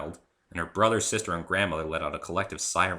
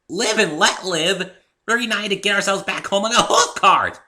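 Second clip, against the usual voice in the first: loud, high and indignant; fighting back at an old friend; heated argument; 13 dB louder than usual. Acted. Heated quarrel between friends.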